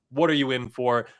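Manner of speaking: normal talking voice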